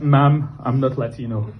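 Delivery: ordinary delivery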